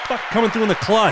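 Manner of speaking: excited shout. Real, not acted. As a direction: yell it like you just won. Celebrating guessing the answer.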